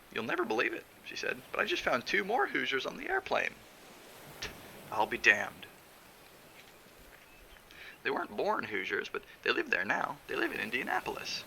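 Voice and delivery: high, eager voice of a chatty wife; excited Hazel. A narrator's reading.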